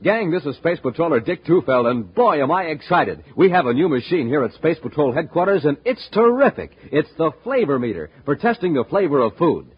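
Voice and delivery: usual speaking voice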